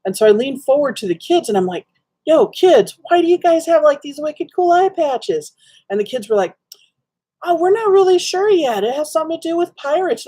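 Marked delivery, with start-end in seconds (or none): 2.2-5.5 put-on high, bright-eyed call to the kids; excited greeting. Voicing herself greeting kids. 7.4-10.2 put-on high childlike voice, unsure; kids' voice. Voicing the kids' reply.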